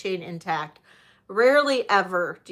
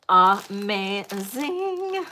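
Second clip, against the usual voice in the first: "Amazing" stretched long and slow; drawn-out gush; much slower than usual. Shown as a demonstration. Seller gushing to viewers, "Amazing" drawn out slowly. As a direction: savour every sound of "Amazing".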